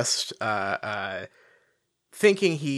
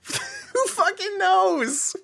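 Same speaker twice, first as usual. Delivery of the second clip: high, slow, laughing disbelief; laughing exasperation; much slower than usual. Real, not acted.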